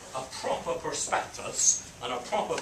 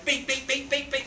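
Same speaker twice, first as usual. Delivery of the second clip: high, rapid run of beeps; imitated pager beeps; 11 semitones higher than usual. Storyteller's pager goes off one day, high beeping. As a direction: mimic a pager suddenly going off.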